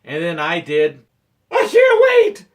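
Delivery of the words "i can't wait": very high, loud outburst; full-force mock panicked cry; desperate shout. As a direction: yell it as if about to burst. Feigned desperate outburst to manager.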